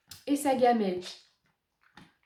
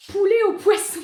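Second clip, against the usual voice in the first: high, loud incredulous read; amused disbelief; 7 semitones higher, 8 dB louder than usual. Real, not acted. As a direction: say it like it's absurd. Reading the flavour in disbelief.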